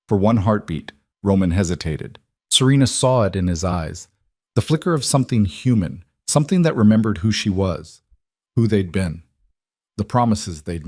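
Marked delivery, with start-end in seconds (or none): none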